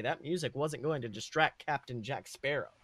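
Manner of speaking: normal talking voice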